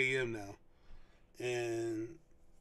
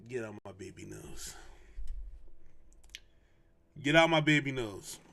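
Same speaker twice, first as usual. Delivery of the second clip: mock protective dad; 3 semitones lower than usual. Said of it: "I still be on the other side of the room, like".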